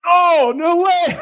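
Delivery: slow loud shout breaking into laughter; anger turning to laughter. Real, not acted. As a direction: shout in shock, then crack up. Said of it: "it's not funny".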